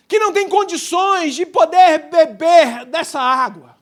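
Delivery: loud, driving pulpit preaching; fervent preaching. Acted. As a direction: preach it out to the whole congregation. Preaching at full force.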